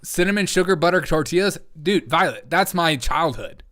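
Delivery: usual speaking voice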